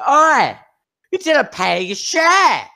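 high, loud innkeeper's holler; indignant shout. Acted. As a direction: yell after customers who left without paying. Innkeeper calls after unpaying customers.

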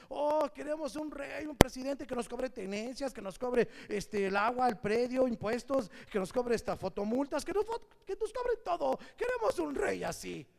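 mock crowd asking to be taxed; mock pleading people. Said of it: "que dijéramos".

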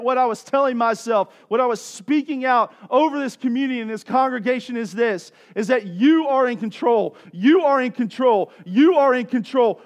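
loud, insistent declarations; full-force preaching. Real, not acted. Preacher speaking out over the congregation, full-force declaration. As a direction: proclaim it over the congregation, drawing out the last words.

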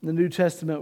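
normal talking voice

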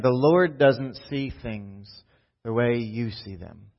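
slow and deliberate, spacing "The Lord doesn't see things"; slow reread. Shown as a demonstration. Pastor rereads the verse slowly so it sinks in. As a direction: lean on each word, slowly. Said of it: "Let's read that again".

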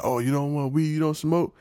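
lightly voiced street seller; mock hustler. Hustler offers weed.

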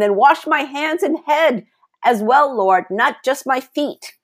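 high-pitched eager exclamation; exclaiming. A narrator's reading. Peter begging Jesus to wash all of him, exclaiming. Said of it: "Simon Peter exclaimed".

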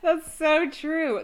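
usual speaking voice

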